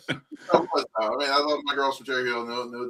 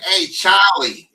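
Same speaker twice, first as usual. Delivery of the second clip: loud, high Philly "Hey, Charlie"; dad's Philly accent; 6 semitones higher, 9 dB louder, much slower than usual. Retold or imitated. Imitating dad's part of town, loud Philly accent. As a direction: call out drawn-out in a Philly accent. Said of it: "But my dad's accent".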